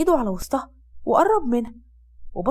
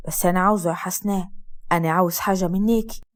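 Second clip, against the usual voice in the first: lowered, steady man's voice; Asad's low male voice; 6 semitones lower than usual. Acted. Husband comforting his sick wife, low male voice. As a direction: speak as the calm husband, low.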